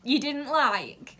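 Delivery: very high, mock sweet request; sarcastic plea. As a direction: needle them with fake politeness. Sarcastic retort to couple.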